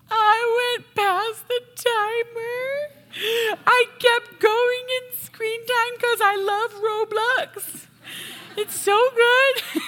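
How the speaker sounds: put-on high, slow, wailing little kid voice; mock crying child. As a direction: play the sobbing child who loves Roblox, drawn out. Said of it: "they just start bawling. And they're like".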